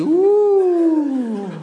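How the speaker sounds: long, loud, very high hammed-up shriek of joy; mock scream. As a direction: shriek like an overjoyed game-show winner. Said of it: "A oni křičí". (přehnaný radostný výkřik, hlasitě a vysoko)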